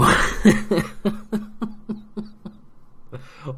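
high breathy laughing in disbelief; exasperated laugh. Real, not acted. Annoyed at only winning nine nil, incredulous laughter. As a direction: laugh at how annoyed you are.

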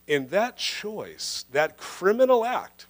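normal talking voice

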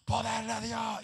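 loud burst of church praise; shouted praise. Retold or imitated. The preacher shouts church praise aloud. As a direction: call out Poder de Dios like a worshipper.

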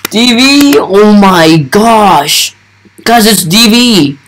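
high, drawn-out excited amazement; starstruck. Real, not acted. Gamer showing viewers the favourite YouTuber, starstruck. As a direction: stretch it out high in thrilled disbelief.

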